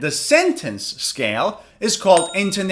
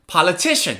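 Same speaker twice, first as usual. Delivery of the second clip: "Politician" in one go, TI stressed; quick "Politician" peaking on the third syllable; faster, third-syllable stress; faster than usual. Shown as a demonstration. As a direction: lean on TI in a joined-up "Politician".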